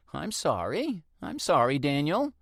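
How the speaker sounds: high, flustered apology in a woman's voice; older woman apologizing. Acted. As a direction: neighbour apologizing for startling him.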